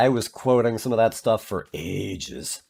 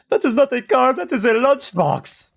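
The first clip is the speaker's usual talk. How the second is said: much higher, emphatic puppet voice; Ahmed impression; 13 semitones higher than usual. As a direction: scorn the car like a comic puppet. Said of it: "Then with Ahmed".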